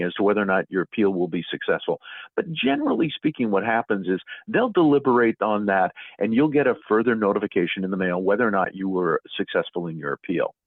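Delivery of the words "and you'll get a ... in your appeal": ordinary talk, nothing marked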